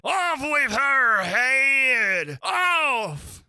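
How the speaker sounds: high, drawn-out screamed order; shrieking royal fury; furious scream. Acted. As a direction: scream it like a raging queen.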